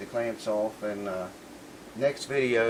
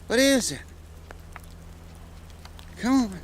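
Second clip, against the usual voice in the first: talking to the dog; 11 semitones higher than usual. Real, not acted. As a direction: coax the dog along, voice up high. Calling his dog in the snow.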